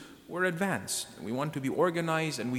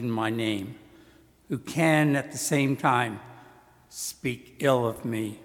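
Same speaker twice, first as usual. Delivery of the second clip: measured, solemn scripture reading; Gospel proclamation; 3 dB louder than usual. Acted.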